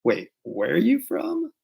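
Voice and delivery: slow, high, rising bewilderment; confused question climbing sharply; puzzled. Acted. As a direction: stretch it out, baffled by an accent. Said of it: "you're just like".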